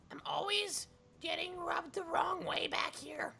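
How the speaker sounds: strained breathy whisper; raspy whisper. Acted. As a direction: rasp it out in a hurt whisper. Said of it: "my voice just keeps on going worse".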